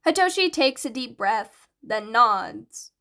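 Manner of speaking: ordinary delivery, nothing marked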